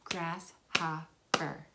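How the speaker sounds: slow "Grasshopper." with each syllable pulled apart; syllables clapped out. Shown as a demonstration. Teacher slowly clapping out the word's syllables for kids. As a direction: stretch "Grasshopper." slowly, one clap per syllable. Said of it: "You clap out the sounds".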